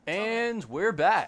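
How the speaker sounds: loud, drawn-out "we're back" announcement; show host comeback. Acted. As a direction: announce the return big and slow. Announcing return after the pause.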